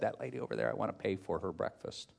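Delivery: hushed, low retold request; very soft, confidential murmur; discreet request. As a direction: drop the voice as if arranging a secret favour. Wife discreetly asking to pay.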